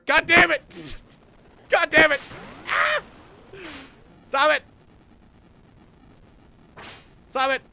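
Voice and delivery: high, loud angry shouts; real rage at the game; furious yelling. Real, not acted. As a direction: yell it in real frustration. Losing the space fight.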